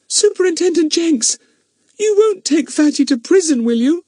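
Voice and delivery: Bets pleading, high and choked up; a little girl begging, voice catching; choky voice. A narrator's reading. Bets pleading with the superintendent, high and choky. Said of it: "in a suddenly choky voice".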